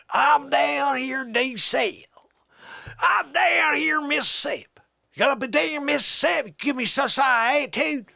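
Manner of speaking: loud, high, hammed-up Southern twang; Southern accent. Country fellow complaining about attitude, Southern accent. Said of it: "never really done a Southern accent before".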